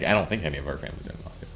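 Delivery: quick playful whisper, half joking; mock whisper. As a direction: whisper it like a secret you're only pretending to keep. Joking about mafia families.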